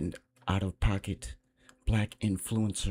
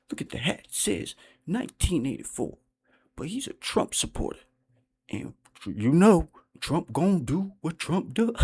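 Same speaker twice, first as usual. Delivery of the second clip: mocking the YouTuber's voice; 7 semitones higher than usual. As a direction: ham up the YouTuber's preachy talk. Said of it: "he talks like this".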